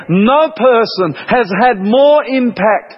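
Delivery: normal talking voice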